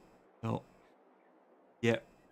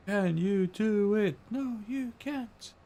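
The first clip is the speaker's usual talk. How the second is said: high mock voice; 10 semitones higher, slower than usual. Mock talking to the tractor.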